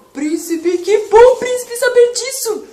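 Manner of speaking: high, loud joyful lion; relieved lion. Acted. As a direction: burst out happy as the lion. Lion relieved by prince's promise.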